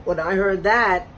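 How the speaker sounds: normal talking voice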